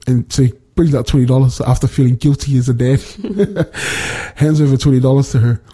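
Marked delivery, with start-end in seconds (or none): 3.0-4.3 soft, wordless laugh; chuckle. Real, not acted. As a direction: laugh lightly at your own story. Chuckles at the guilty dad.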